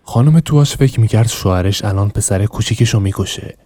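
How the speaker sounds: ordinary delivery, nothing marked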